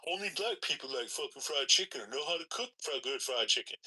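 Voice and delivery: lightly put-on quote, starting high; mock gatekeeper. Man imitating food gatekeepers, lightly mocking. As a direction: mimic the claim dismissively. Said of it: "I just hate the fucking gatekeeping".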